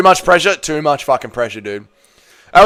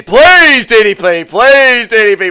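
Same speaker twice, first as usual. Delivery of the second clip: high, louder begging "Please" each time; pleading cry; 5 semitones higher, 6 dB louder, slower than usual. Real, not acted. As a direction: beg the fighter to win. Begging for his fighter.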